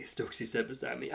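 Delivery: ordinary delivery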